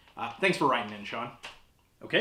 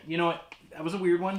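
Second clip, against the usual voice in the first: normal talking voice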